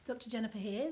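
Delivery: usual speaking voice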